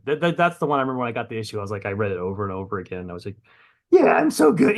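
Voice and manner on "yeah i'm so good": high, loud, smug self-congratulation; mock arrogant boast. Writer rereading his own comic, mock smug and delighted. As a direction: puff up like you're the best writer alive. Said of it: "you get arrogant about it".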